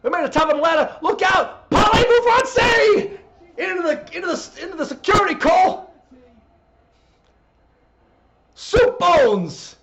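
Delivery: high, loud wrestling commentator impression; Taz, excited. Calling the balcony climb to Cole, excited Taz. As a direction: shout the play-by-play to your broadcast partner. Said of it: "as an excited Taz".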